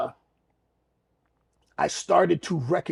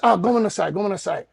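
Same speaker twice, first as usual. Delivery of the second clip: hurried apology in Japanese, higher-pitched; retold apology; 6 semitones higher, 7 dB louder than usual. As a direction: apologize sheepishly to onlookers. Apologizing to startled diners.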